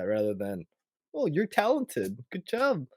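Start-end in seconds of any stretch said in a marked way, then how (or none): 1.1-2.9 high-pitched mock gushing praise. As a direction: coo it like an over-impressed parent. Voicing a gushing parent's praise.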